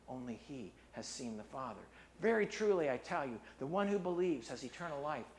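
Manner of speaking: measured preaching read of Jesus' words; scripture reading. Acted. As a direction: read the passage with gravity.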